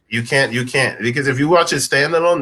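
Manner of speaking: ordinary talk, nothing marked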